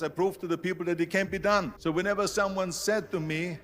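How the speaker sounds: ordinary talk, nothing marked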